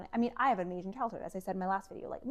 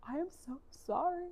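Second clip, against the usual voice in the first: higher, choked mock-sobbing apology; imitated sobbing; 7 semitones higher than usual. Wife confessing to husband on the phone, imitated sobbing. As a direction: act out a tearful confession.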